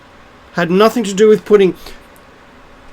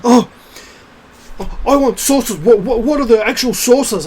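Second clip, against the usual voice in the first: higher, put-on voice of a demanding challenger; mock the cafe know-it-all's protest; mocking imitation; 6 semitones higher than usual. Mimicking the cafe man demanding sources, mocking.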